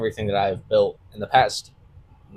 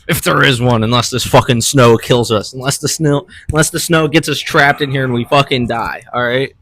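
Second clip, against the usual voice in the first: loud joking rant; 2 semitones higher, 7 dB louder than usual. Acted. Joking doom rant about snow.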